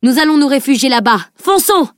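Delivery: rally them and yell go; urgent command. A narrator's reading. Edwin orders everyone to the trees, building to a shout.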